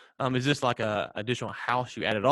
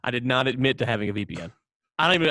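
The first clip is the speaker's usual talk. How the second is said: ordinary talk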